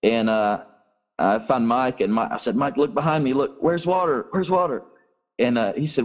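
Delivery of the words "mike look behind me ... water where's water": high, anxious calls to a friend; retold urgent call. Pastor retelling how he called to his friend Mike, mildly urgent. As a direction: reenact the alarm at the crowd following.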